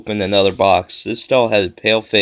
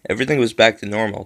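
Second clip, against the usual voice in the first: ordinary delivery, nothing marked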